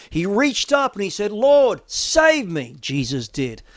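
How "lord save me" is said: loud, high desperate plea; loud, high cry of Peter sinking; cry for help. Acted. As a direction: call out as if drowning.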